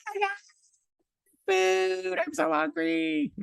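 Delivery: very high mock wail; imitating her. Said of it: "She's like".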